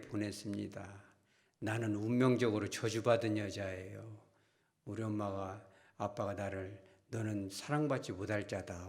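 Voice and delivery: lightly voiced unhappy daughter, low and quiet; 사춘기 딸의 신세 한탄. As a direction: recount her wretched fate softly, a touch of sorrow. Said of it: "사춘기가 딱 되면서 말을 해요". (나직하고 낮게, 살짝 연기한 딸의 신세 한탄)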